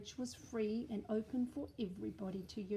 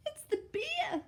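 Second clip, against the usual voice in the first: much higher, loud frightened cry; 7 dB louder than usual. Acted. Eddie spotting the bear, crying out high in fright. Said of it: "cried Eddie".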